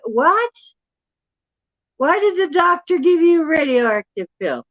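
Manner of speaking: can't believe what you just heard; shocked disbelief. Retold or imitated. Shocked by stranger at thrift shop.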